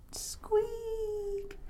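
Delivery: very soft, high, long creak; door squeak. Acted. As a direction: squeak like a slowly closing door.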